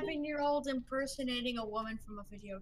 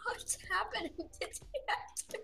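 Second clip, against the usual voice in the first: high, hushed laughing squeal; laughing disbelief; 8 semitones higher, 5 dB quieter than usual. Real, not acted. Player giggling over what the game has become, laughing disbelief. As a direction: squeak it out softly through laughter.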